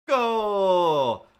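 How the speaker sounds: huge loud commentator yell; goal shout. Pretend. Sudden sports shout.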